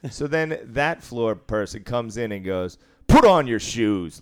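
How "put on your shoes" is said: louder and slow, a flat command; blunt loud order. Retold or imitated. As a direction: bark it at him slowly. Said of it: "like that".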